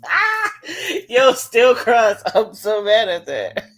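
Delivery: loud laughing outburst. Real, not acted. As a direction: crack up through the words. Cracking up at own joke.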